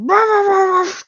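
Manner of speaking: high, rolling bark imitation; angry dog barking. Game master voices the guard dog, angry barking. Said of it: "an angry dog just".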